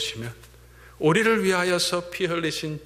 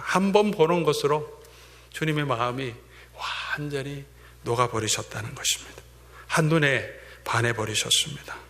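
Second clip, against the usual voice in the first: usual speaking voice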